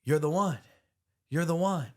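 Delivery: imitating a booming motivational speaker; Les Brown impression. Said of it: "He's like".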